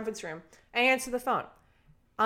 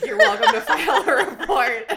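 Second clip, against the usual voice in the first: laughing; 11 semitones higher, 10 dB louder than usual. Real, not acted. Quoting police, cracking up.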